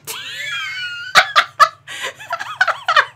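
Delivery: a sudden high squealing burst of laughter; wild shrieking laugh. Real, not acted.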